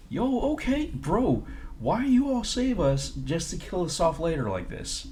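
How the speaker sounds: high, loud outraged yo bro; loud indignant protest; outraged yell. Real, not acted. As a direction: yell back at the jailer in disbelief. Outraged at the jailer.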